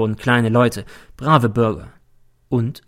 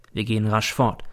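ordinary delivery, nothing marked

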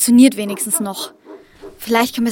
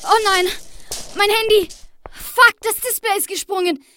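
frightened; 10 semitones higher than usual. Acted. Phone breaks, frightened in dark.

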